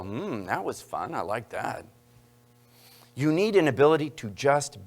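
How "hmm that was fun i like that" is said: put-on smug quiet kid. Kid enjoying a mean text, sly and quiet. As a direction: savor the cruelty quietly. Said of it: "then they go".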